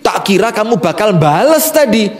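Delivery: ordinary talk, nothing marked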